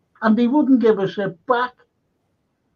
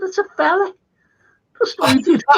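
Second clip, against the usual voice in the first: higher, gleeful reveal; spring the punchline; mate's reveal; 11 semitones higher, faster than usual. Retold or imitated. Mate reveals the joke.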